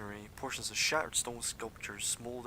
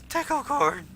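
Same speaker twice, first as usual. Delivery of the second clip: very high, loud kobold call; shrieky high kobold shout; 11 dB louder than usual. Acted. Kobold guide calling to armed kobolds, high-pitched loud call. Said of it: "he calls out".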